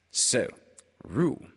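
ordinary delivery

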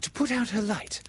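put-on high, urgent girl's plea; pleading high as a worried girl; begging. A narrator's reading. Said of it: "Wendy begged".

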